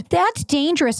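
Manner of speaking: pitched well up, imitating shocked people; mock alarm. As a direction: play a scandalized friend, hammed-up. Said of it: "So many people that were like".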